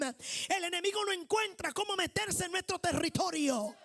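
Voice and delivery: loud, driving sermon delivery; full-force preaching. Acted. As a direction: preach it out to the congregation.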